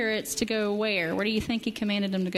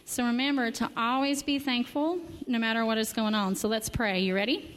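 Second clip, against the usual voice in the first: usual speaking voice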